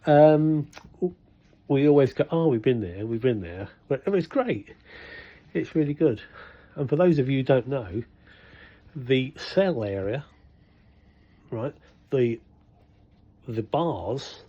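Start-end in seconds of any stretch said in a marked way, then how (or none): none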